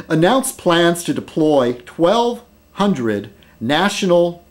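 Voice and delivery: normal talking voice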